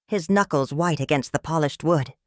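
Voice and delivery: ordinary delivery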